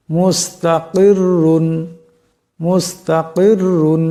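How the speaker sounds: মুস্তাকিরুন slowly, র heavy with tanween. Shown as a demonstration. Teacher slowly showing মুস্তাকিরুন with a heavy র. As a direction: stretch মুস্তাকিরুন with a thick র. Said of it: "এই রাকে মোটা আওয়াজে পড়তে হবে".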